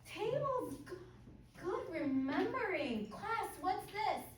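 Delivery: over-enunciate for beginner English learners; kids show host. Shown as a demonstration. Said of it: "But I want to do one with you".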